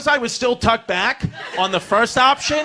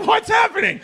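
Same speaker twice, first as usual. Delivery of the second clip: high, bewildered squeak; high confused question; 13 semitones higher, 3 dB louder than usual. Retold or imitated. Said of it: "I'm like".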